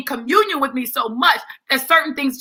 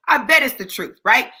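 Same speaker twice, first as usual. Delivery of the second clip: ordinary delivery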